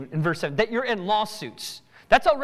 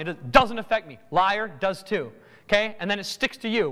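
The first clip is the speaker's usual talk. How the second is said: normal talking voice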